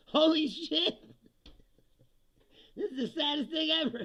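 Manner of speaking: high, loud, laughing through the words; mock dismay. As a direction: crack up while calling it the saddest thing ever. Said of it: "and you're like".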